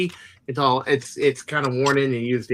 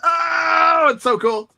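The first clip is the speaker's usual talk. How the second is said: excited shout; 12 semitones higher, much slower than usual. Real, not acted. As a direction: cry out with glee. Excited over the blaster.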